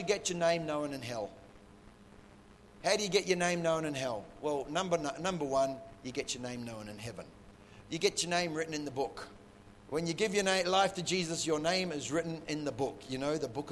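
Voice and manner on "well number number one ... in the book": usual speaking voice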